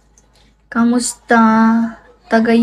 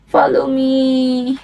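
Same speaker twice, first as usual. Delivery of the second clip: slow, drawn-out follow me; slow deliberate follow me; slow firm; slower than usual. Shown as a demonstration. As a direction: say it slowly and clearly. Repeating follow me variously.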